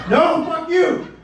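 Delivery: slow, high, loud shout; furious shout. Acted. Comic to the clerk, slow high shout. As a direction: bellow it back, dragged out.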